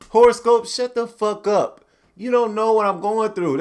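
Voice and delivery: ranting at horoscopes; mock yelling. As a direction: yell at the horoscope.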